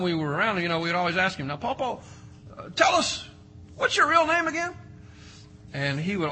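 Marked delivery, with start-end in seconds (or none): 1.5-4.7 put-on high childish pleading voice; grandkid voice. Grandkids begging Paw Paw for his real name, high and childlike. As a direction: pitch it up like an eager little kid.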